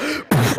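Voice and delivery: imitated dramatic intake of breath; mock transformation gasp; sudden sharp gasp. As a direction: gasp as if the music just hit. Girl changes when music starts.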